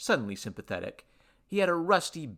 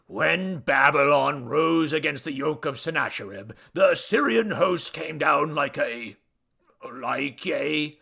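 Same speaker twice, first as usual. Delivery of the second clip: loud, raised epic declamation, faltering at the end; pompous storytelling god; 4 semitones higher, 7 dB louder than usual. Acted. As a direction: orate grandly, then grope for the word. God orates, then loses the simile.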